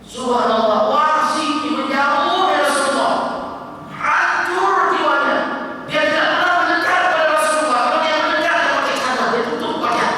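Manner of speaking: high, loud fervent sermon; impassioned preaching. Acted. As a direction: preach it with rising fervour. Preaching at full force. (khotbah berapi-api)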